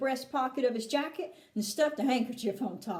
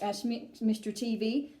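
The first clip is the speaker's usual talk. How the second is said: plain reading voice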